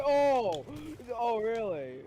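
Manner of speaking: sarcastic surprise. Real, not acted. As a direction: exclaim in mock shock. Mock disbelief at reply.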